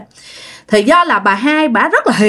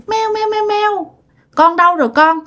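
calling for cat; 10 semitones higher than usual. Acted. Old woman looks for her missing cat, high-pitched calling. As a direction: call out in a high voice for a lost pet. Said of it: "bà lại kêu".

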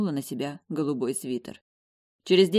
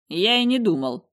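feigned indifference; 5 semitones higher, 6 dB louder than usual. A narrator's reading. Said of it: "делано равнодушно отозвался он".

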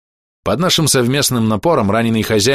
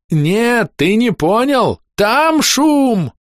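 very high, drawn-out cry; insistent yelling; 12 semitones higher, much slower than usual. Acted. Goblin insists to his companions over the din, yelling. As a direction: yell to make them understand, stretching the words.

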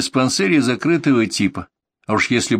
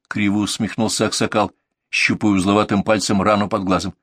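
ordinary delivery

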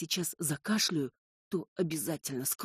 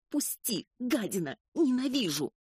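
high, loud furious yell; furious scream; 8 semitones higher, 4 dB louder than usual. A narrator's reading. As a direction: scream it, struggling in his grip. Heroine struggles, screaming.

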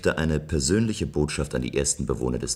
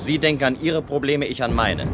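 defiant; 9 semitones higher, 4 dB louder than usual. Acted. Defiant reply in quarrel.

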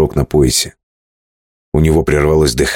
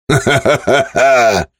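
fearless laugh; 9 semitones higher, much slower than usual. Acted. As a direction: laugh off the danger boldly. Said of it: "бесстрашно рассмеялся". (бесстрашный смех)

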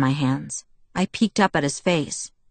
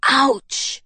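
higher-pitched cursing in pain; pained complaint; 9 semitones higher, much slower than usual. A narrator's reading. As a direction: complain sharply about a fresh cut. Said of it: "he complained".

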